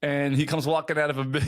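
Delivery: usual speaking voice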